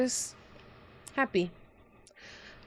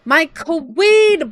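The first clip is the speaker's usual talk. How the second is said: drawn-out excited call; 6 semitones higher, 8 dB louder, much slower than usual. Real, not acted. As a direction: call it out with glee. Excited shout greeting a viewer.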